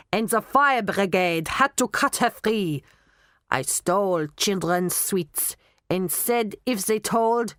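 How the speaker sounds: put-on mounting German-accented list of misdeeds. Knockman confessing all his nasty deeds, German accent. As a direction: reel off the crimes in a thick German accent. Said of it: "you will talk in a German accent".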